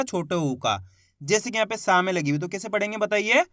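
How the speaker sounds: normal talking voice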